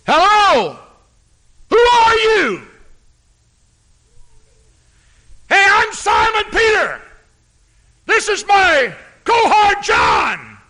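high, loud shouting to a stranger; calling out. Acted. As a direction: holler across the water at the shape. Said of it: "by saying".

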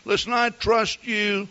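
breezy, carefree wife telling him not to worry; lightly voiced carefree wife; wife's voice. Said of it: "She says".

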